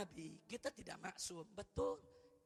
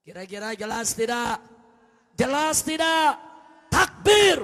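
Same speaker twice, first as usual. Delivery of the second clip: rallying the congregation; 20 dB louder than usual. Real, not acted. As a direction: build loudly to a commanding call for takbir. Rouses the congregation. (seruan takbir yang makin lantang)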